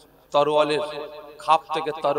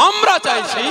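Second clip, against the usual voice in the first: fiery preaching; 11 semitones higher than usual. Real, not acted. Preacher rousing the crowd in defence of the Prophet, fiery. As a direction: hammer home each phrase to the crowd.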